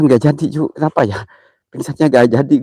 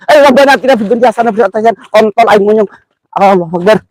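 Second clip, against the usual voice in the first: panicked prayer; 7 semitones higher, 4 dB louder, faster than usual. Real, not acted. Panicked prayer during ghost hunt.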